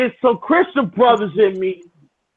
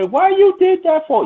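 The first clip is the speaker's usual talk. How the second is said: higher mock ex-wife complaining; ex-wife's voice; 10 semitones higher than usual. Ex-wife scolding him, pitched-up imitation.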